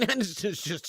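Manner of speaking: ordinary talk, nothing marked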